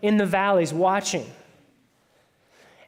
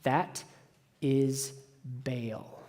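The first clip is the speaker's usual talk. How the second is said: hushed reveal; 7 semitones lower, 10 dB quieter, much slower than usual. Real, not acted. Dramatic reveal of the god.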